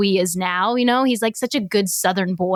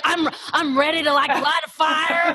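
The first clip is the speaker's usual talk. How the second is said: put-on Southern accent; 7 semitones higher than usual. Husband bragging about prepping, in a Southern accent. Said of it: "the accent came out".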